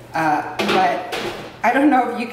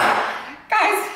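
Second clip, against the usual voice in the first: calling out; 13 semitones higher than usual. Real, not acted. Calling out to viewers.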